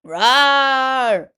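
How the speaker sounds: huge, loud roaring shout; bear roar. Acted. Bear roaring after losing the dreidel game. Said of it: "Old Bear roared".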